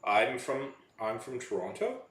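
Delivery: Canadian upspeak rising on "Toronto"; statement turned question, Canadian style; Canadian accent. Shown as a demonstration. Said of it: "it always sounds like they're asking a question".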